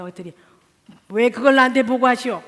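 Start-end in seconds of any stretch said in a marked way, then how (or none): 1.1-2.4 mock gruff husband.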